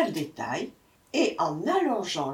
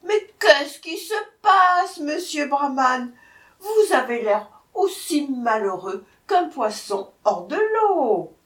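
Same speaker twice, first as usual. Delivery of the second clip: calling out; 7 semitones higher, 7 dB louder than usual. A narrator's reading. Jackal hailing the unhappy brahman, calling out. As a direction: cry it out high with stretched words. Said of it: "le chacal lui cria".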